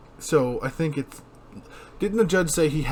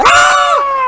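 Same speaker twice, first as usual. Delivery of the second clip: startled shout; 12 dB louder than usual. Retold or imitated. Startled shout in movie scene.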